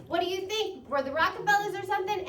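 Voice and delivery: high, fast scoffing rebuke; mock dad's catchphrase; imitating dad. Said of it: "he would say".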